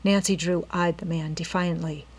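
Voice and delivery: ordinary delivery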